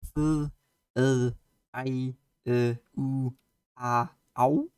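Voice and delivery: Icelandic letters F, L, I, E, U, A, Au said one by one; Icelandic letter names. Shown as a demonstration. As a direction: spell out the Icelandic letters with pauses between. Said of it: "we learned about the alphabet and letter pronunciation".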